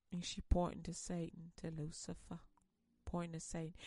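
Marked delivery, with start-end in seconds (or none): none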